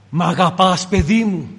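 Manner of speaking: ordinary talk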